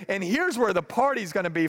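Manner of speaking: usual speaking voice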